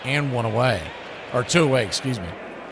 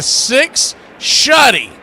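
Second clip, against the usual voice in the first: ordinary delivery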